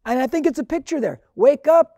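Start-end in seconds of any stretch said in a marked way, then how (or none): 1.4-2.0 higher, louder summons "Wake up"; fervent rallying cry from the pulpit; preacher's call. Acted. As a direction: ring it out to rouse the sleepers. Preacher calling out to the congregation.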